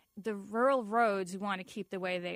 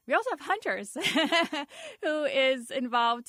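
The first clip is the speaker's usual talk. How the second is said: laughing; 6 semitones higher, 7 dB louder than usual. Real, not acted. Amused at hunters joining opponents.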